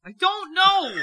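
yelling. Real, not acted. Co-host answers being asked why they're yelling, yelling back. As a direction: yell it high, dropping at the end. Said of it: "Why are you yelling?".

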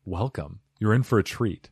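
ordinary delivery

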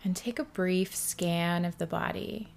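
soft, hushed meditation guide with long pauses; guided meditation. Acted. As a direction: ease the listener into stillness, softly and unhurried.

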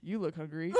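quiet, low retold remark from a stranger; lightly voiced creepy customer; imitating the odd man. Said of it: "He looks her up and down and goes".

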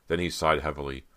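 ordinary delivery

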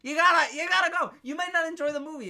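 high, loud interjection; insistent. Real, not acted. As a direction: push back hard at your co-host. Arguing back at co-host.

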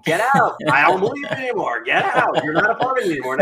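loud, mock-dramatic banishing; out-loud self-talk. Man walking down the mall loudly ordering doubt out. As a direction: call it out loud as if alone in public. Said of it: "I talk like this out loud".

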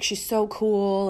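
slow, drawn-out mocking gush. Mocking her ex as he gushes about the lawyer, slow mock gush. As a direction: stretch it out, teasing her ex.

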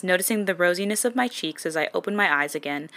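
usual speaking voice

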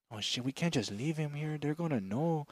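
hushed panic. Retold or imitated. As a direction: whisper it like a dad planning a getaway. Dad voiced in story, hushed.

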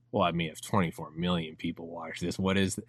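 low, soft voice of a swayed viewer; mock musing. Acts viewer swayed by view count.

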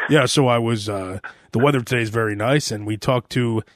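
deadpan parody of a local TV reporter. Retold or imitated.

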